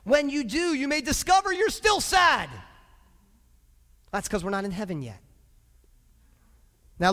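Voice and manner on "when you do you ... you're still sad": high, loud, stretched-out shouts; impassioned, raised-voice exhortation; full-force preaching. Real, not acted. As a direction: urge the congregation on at full voice.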